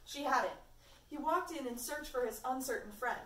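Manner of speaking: plain reading voice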